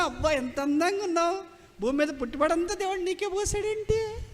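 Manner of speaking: high, rising, hammed-up flattery; mock gushing admiration. Admirer praising someone's beauty, mock gushing. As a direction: play an awestruck admirer, pitch climbing to the end.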